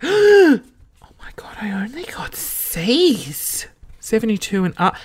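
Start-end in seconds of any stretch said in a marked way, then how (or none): 0.0-3.7 high gasp into slow whisper; aghast, hushed and drawn out; horrified gasp. Real, not acted. Reader discovering poor grades on the report card, horrified whisper.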